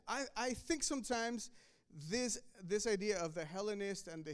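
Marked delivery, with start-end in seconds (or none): none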